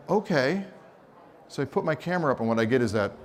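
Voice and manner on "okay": surprised okay. Retold or imitated. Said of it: "And I went".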